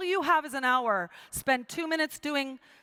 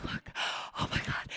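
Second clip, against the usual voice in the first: breathless gasp; 8 dB quieter than usual. Retold or imitated. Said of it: "I'm hyperventilating".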